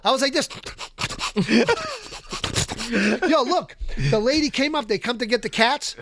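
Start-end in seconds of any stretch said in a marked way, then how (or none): 0.5-3.2 wheezing through a long laugh; long breathless laugh. Real, not acted. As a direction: laugh it out, winded. Laughing at own story.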